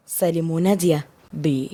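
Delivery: ordinary delivery, nothing marked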